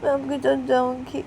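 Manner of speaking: put-on high, slow, hurt little girl; child explaining and begging mummy; small girl voice. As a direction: talk like a small hurt daughter. Child voice held.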